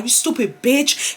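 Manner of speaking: ordinary delivery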